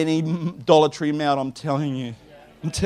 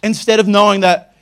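usual speaking voice

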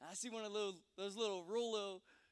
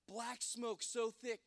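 ordinary delivery

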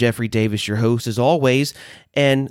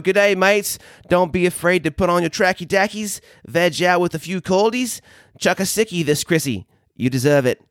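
put-on higher-pitched Australian accent; 7 semitones higher than usual. Host signing off his slang message to listeners, Australian accent. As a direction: put on a playful Aussie accent, lifted in pitch. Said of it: "in my best Australian accent".

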